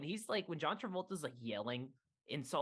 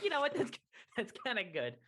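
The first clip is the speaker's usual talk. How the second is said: high, loud start breaking into a laugh; amused reaction; 9 semitones higher, 3 dB louder than usual. Retold or imitated. Podcaster retelling own reaction to the film, amused. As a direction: recall being won over, high-pitched and laughing.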